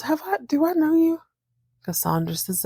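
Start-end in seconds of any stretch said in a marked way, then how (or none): 0.0-1.2 puzzled. Retold or imitated. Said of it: "And Angela goes".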